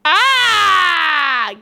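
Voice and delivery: loud cry of realisation; realising shout. Real, not acted. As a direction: shout out in sudden discovery. Sudden realisation, cries out.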